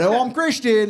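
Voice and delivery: badly faked English pimp voice; English pimp accent. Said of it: "like an English pimp".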